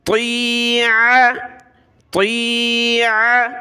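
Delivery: ती आ with ain pushed from the throat, slow; throat sound ain. Shown as a demonstration. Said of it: "आइन को गले से निकाला".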